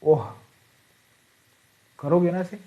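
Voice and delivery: short gasp of surprise; surprised oh. Shown as a demonstration. Teacher demonstrating surprise to students. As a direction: show how you'd react in amazement. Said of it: "जब आप एकदम आश्चर्य हो जाओगे".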